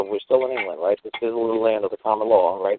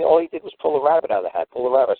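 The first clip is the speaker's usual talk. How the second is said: normal talking voice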